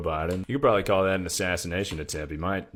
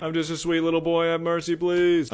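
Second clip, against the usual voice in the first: put-on pleading little boy; 9 semitones higher than usual. Streamer plays innocent boy begging mercy, high mock pleading. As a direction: beg like a sweet little boy.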